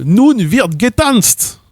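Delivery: high, slow, announcing; German passive example. Retold or imitated. Showing the German passive as a host announcing a dance. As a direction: proclaim it slowly like a ballroom host. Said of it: "vous dites".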